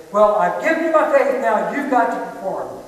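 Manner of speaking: mock demand, pushing hard and loud. A believer backing God into a corner, demanding loudly.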